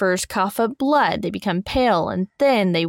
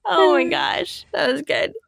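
laughing; 9 semitones higher than usual. Real, not acted. Recovering from laughter.

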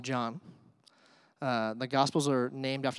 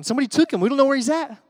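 mock panic; 10 semitones higher, 9 dB louder, faster than usual. Voicing the disciples' panic.

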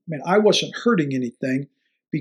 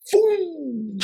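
high mock boom; 12 semitones higher than usual. Imitating hard hit.

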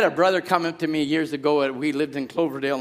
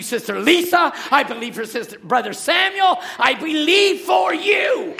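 climbing higher and louder into a shout; building to a shout; 10 semitones higher, 3 dB louder than usual. Real, not acted. Preacher naming the congregation, building shout. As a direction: build each name until you shout. Preacher declaring faith for members.

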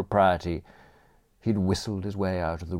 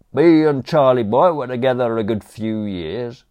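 high, loud cockney manservant offering; chirpy servant barging in with condiments; cheeky butler; 4 semitones higher, 6 dB louder than usual. Acted. Butler Crawshaw to Aunt Agatha, loud chirpy cockney offer.

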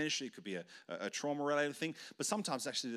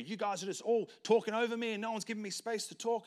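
high, raised, aggrieved protest; mock angry complaint; 9 semitones higher, 4 dB louder than usual. Team member complaining nobody lets him talk, high aggrieved mock protest. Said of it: "they start responding angry".

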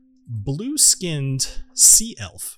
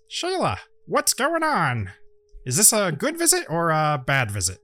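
higher, drawn-out gnome greeting; whip-carrying gnome adventurer; 4 semitones higher than usual. Acted. Gnome greets Shayla as he leaves his tent, higher, drawn-out character voice. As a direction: play the gnome, stretching out the name Shayla. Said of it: "or sorry, he says".

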